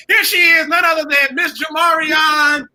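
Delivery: loud, slow emcee call; announcer introduction. Acted. Host introducing the third guest, loud announcer call. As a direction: present her like a star.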